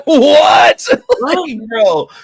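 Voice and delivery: bursts out laughing, loud and high; cracking up hard at the memory; laughing shout. Real, not acted. Laughing loud while telling friend about the checkout girl. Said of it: "not even joking".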